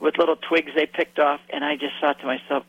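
normal talking voice

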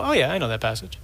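low, offhand, unimpressed; complacent shrug. Retold or imitated. Listeners hearing a shocking passage, complacently shrugging it off. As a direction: toss it off as old news. Mimics complacent hearers of scripture.

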